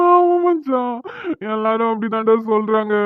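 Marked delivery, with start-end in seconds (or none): 0.1-1.0 high breaking cry on the yes. Acted. Groom admits to his friend he is married, crying. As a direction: wail it out as the voice cracks.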